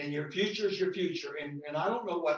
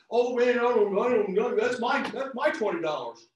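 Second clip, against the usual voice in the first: louder mock grumble, clutching the money; stingy mock whine; 3 semitones higher, 6 dB louder than usual. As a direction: ham up the reluctant giver. Mocking a stingy believer.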